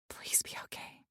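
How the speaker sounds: hushed, fearful whisper. A narrator's reading. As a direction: whisper it like a frightened prayer. Frightened woman praying alone.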